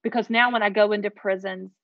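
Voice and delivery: normal talking voice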